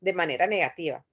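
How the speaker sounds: ordinary talk, nothing marked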